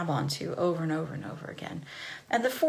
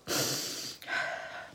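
whispery audible breath; imitated calming breath, in and out; deep breath; 6 dB quieter than usual. Kid pausing before new biscuits, whispery deep breath.

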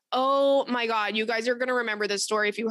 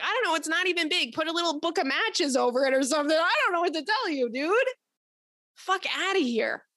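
sarcastic retort; 5 semitones higher than usual. Retold or imitated.

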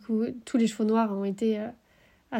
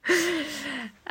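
short exasperated laugh; annoyed laugh; 5 semitones higher than usual. Real, not acted. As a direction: laugh in disbelief. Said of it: "ça m'énerve encore".

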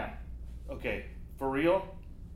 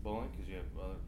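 hurt; 4 semitones lower, 7 dB quieter than usual. Acted. Hurt caller learns of friends.

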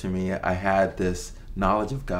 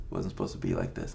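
dropped much lower and rushed, to himself; low fast repeat; 13 semitones lower, 6 dB quieter, faster than usual. Retold or imitated. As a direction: repeat it lower and faster, half to yourself. Repeats the despairing thought.

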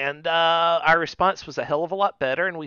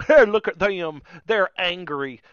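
louder, higher taunting jeer; gloating mock; 3 semitones higher, 7 dB louder, slower than usual. Quoting fans who enjoy others' anger, loud gloating jeer. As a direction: crow like a gloating troll. Gloating fan quoted mockingly.

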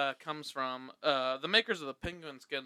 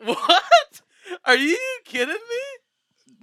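surprised; 9 dB louder, much slower than usual. Acted. Hammy ad skit, fake surprise.

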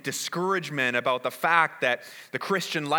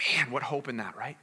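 whispered awe; 4 semitones lower, 6 dB quieter than usual. Real, not acted. Whispered awe after verse.

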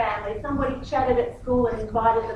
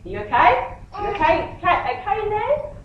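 acted mum checking in, pitch climbing; lightly hammed-up concern, rising higher; concerned calling-out; 10 semitones higher, 4 dB louder than usual. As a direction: call tentatively into the next changing room. Mum calls into next changing room.

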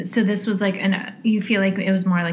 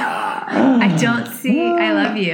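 laughing disbelief; 6 semitones higher, 5 dB louder, much slower than usual. Real, not acted.

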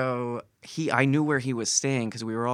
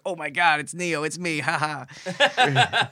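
imagined delighted Keanu; 5 semitones higher, 3 dB louder, slower than usual. Retold or imitated. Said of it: "he'll look at it and be like".

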